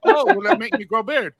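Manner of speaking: put-on Chinese accent. Visitor from China asking about beard oil, Chinese accent. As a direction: imitate a Chinese visitor with little English. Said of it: "I have to do the accent thing".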